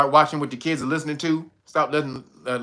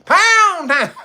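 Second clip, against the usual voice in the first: "Pound" yelled high and loud with a laugh; 14 semitones higher, 7 dB louder than usual. Pretend. Streamer retries the lyric, loud and high laughing.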